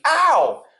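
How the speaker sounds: high, loud falling yelp; Pooh's pained yelp. A narrator's reading. Pooh bumping the jar on his head, high pained yelp. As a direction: yelp as if bumped. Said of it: "and mostly".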